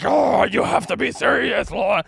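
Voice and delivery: loud, slow scolding teacher voice; mock stern teacher. Teacher scolding the student, loud and slow. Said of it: "she's like".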